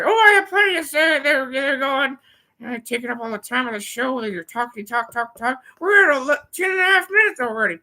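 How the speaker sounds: a brash sidekick butting in loud and high; Nick Rack voice. Acted. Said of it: "See if Nick Rack has anything to say".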